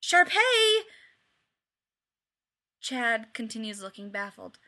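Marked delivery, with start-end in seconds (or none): 0.0-0.9 high, loud desperate yell; yelling for help. A narrator's reading. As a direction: call out through cupped hands. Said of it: "he yells".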